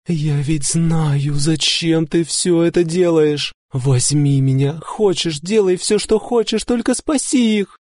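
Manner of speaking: frantic plea; desperate offering. A narrator's reading. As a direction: offer everything, voice breaking. Woman offering herself desperately.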